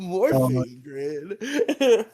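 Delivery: laughing narrator voice. Retold or imitated. Cracking up mid TikTok narrator impression, slow laughing drawl. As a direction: crack up mid-promo line and drag it out. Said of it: "a TikTok narrator guy being like".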